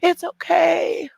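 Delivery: hammed-up soothing, rising to a near shout; mock comforting women. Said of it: "the women are gonna go".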